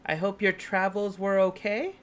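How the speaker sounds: high, sly villainess voice; sweetly menacing pirate queen; evil villain voice. Acted. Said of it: "Sounds like nothing like her".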